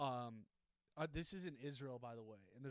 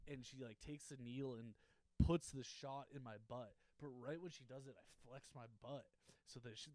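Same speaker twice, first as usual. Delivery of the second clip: ordinary talk, nothing marked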